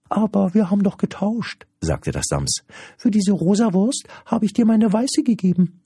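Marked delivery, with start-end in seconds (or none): 0.1-1.7 very high squeaky creature protest; high-pitched creature arguing back; protesting little creature. Acted. 3.0-5.8 very high squeaky creature protest; high-pitched creature arguing back; protesting little creature. Acted.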